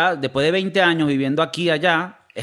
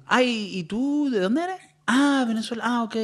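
high, softer mimicked voice; second questioner; 7 semitones higher, 4 dB quieter, slower than usual. Second person asking where he's from, high mimicked voice. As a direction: lilt up high like a curious stranger. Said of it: "me pasa una segunda vez".

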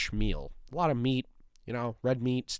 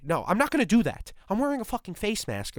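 ordinary talk

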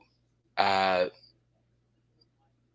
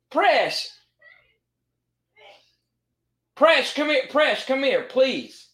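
calling the dog; 4 dB louder, slower than usual. Real, not acted.

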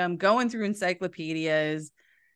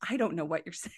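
baffled by Scots; 4 semitones lower, 6 dB quieter, much faster than usual. Retold or imitated. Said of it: "you're like".

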